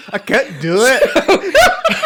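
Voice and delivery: loud, high, drawn-out Scottish parody; laughing, hammed-up Scottish accent; mock Scottish. Co-host teasing the failed impression, exaggerated mock Scottish. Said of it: "Dude, a little Scottish".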